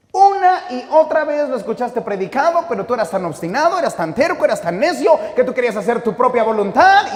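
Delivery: loud, high, ringing preacher's voice; full-force preaching. Acted. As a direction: preach it out with fire. (predicación a todo pulmón, fuerte y aguda)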